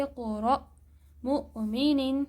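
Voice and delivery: اقرأ and مؤمنین slow, each hamza caught sharply; slow correct recitation with clear glottal stops; with a jerk. Shown as a demonstration. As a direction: demonstration of the stopped hamza. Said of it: "جھٹکے سے پڑھا جاتا ہے".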